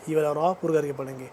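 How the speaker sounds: ordinary talk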